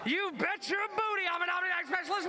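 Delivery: hyped-up host shouting; excited shout. Real, not acted. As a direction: pump up the crowd. Host hyping up the crowd.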